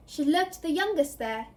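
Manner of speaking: ordinary delivery, nothing marked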